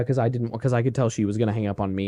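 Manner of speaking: ordinary delivery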